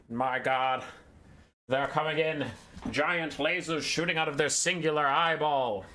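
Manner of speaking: breathless alien-invasion radio bulletin; 1920s newscaster voice. Pretend. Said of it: "Isn't that Orson Welles? The War of Worlds?".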